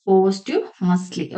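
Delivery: "Posthumously" said slowly as POST-hyu-mus-lee, every syllable clear; pronunciation demo. Shown as a demonstration. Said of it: "posthumously എന്നാണ് pronounce ചെയ്യുന്നത്".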